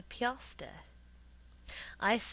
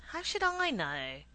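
put-on higher-pitched boy's retort; protesting; 4 semitones higher, 4 dB louder than usual. A narrator's reading. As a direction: protest your innocence. Boy protesting to his mother.

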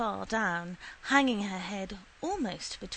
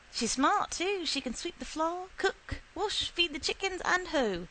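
put-on the old woman's voice climbs high and proud, with a catch in it; proud, rising pitch; 7 semitones higher than usual. Mother boasting of her daughter to Shiflet, high and proud. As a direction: raise the pitch and plead her daughter's worth.

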